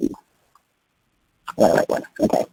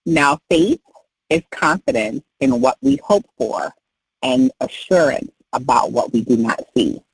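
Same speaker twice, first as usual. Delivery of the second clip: slow, broken into phrases; measured recital with clear pauses; slow recitation. Shown as a demonstration. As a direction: break it down slowly, pausing between phrases.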